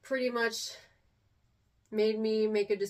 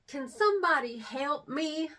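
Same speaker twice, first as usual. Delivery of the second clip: put-on little kid Texas accent; 6 semitones higher than usual. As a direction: imitate her small-child self asking for help, thick Texas accent. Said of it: "I had a pretty thick accent".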